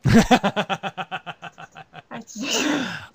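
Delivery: high, loud, long burst of laughter. Real, not acted. Host reacting to a fan's praise, long laugh.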